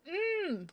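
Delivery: high delighted mmm. Real, not acted. Tasting the snack.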